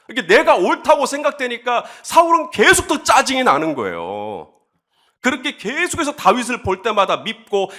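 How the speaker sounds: ordinary talk, nothing marked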